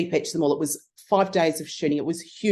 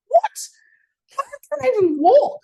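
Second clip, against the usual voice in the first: retold shocked protest; 5 dB louder than usual. Drunk, told to paint cyc.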